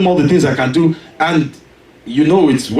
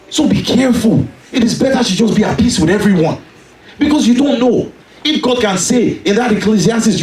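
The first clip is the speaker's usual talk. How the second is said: urgent, higher sermon voice; raised preaching; 5 semitones higher than usual. Acted. Preacher urging peace with everyone, urgent and raised. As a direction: press the warning home.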